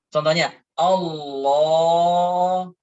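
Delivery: "Allahu" with the first A stretched far too long; wrong drawn-out Allahu. Shown as a demonstration. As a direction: drag out "Allahu" slowly, the wrong way. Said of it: "Nah, ini salah". ("Allahu" dipanjangkan salah, pelan sekali)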